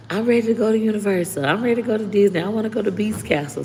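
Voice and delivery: imitating her son begging to go to the parks; excited kid. Son pleading with mom about Disney trip, eager kid voice. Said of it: "he talking about".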